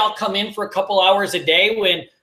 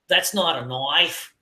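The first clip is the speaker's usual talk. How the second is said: Australian line with stress on "not", from Crocodile Dundee; "That's not a knife" in a slow Aussie voice; Australian accent; 6 semitones lower, 4 dB quieter, slower than usual. Retold or imitated. Quoting Crocodile Dundee in an Australian accent. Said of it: "I just think of Crocodile Dundee".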